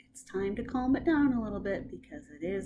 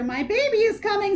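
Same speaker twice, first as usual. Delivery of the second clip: high, loud and fast panic; panicky; 10 semitones higher, 8 dB louder, faster than usual. Acted. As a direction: blurt it out in alarm. Pregnant woman panics.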